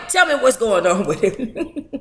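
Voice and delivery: laughing through the words. Real, not acted. Streamer cracking up after her rant, laughing. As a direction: let the laugh break in.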